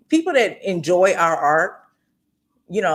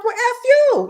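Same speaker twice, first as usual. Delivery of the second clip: high, louder sassy retort like Aunt Esther; Aunt Esther comeback; 5 dB louder, slower than usual. Retold or imitated. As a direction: fire it back like a sitcom auntie. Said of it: "one of them Aunt Esther's from Friday".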